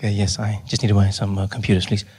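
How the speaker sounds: low, hushed-style hum; confidential voice. Acted. Customer asking shop assistant for computers, low confidential voice. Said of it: "if you speak softly or if you whisper".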